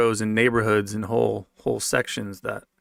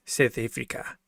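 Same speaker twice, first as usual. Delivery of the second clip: South African accent attempt; 4 dB quieter than usual. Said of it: "Trying to get that accent down".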